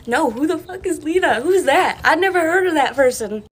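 high, loud, disbelieving yell; incredulous shout. Retold or imitated. To the intruder choking her, a high outraged yell.

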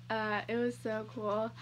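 ordinary talk

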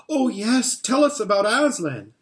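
put-on children begging to hear about Aslan; eager children. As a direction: burst out eagerly together. Several children at once eager.

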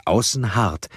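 ordinary delivery

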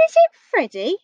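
high, wondering question; surprised Eddie. A narrator's reading. Storyteller voices Eddie finding a giant teddy, surprised. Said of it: "said Eddie".

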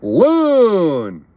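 high, loud loon cry; loon call. Shown as a demonstration. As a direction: belt it out like a loon. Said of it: "I was challenged to do the loon call".

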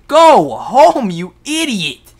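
high, full-volume mock yell; yelling viewers. Imitating viewers yelling at their screens, loud high yell. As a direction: scream it like a fed-up viewer at the screen. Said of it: "you guys are yelling at your screen".

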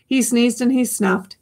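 plain reading voice

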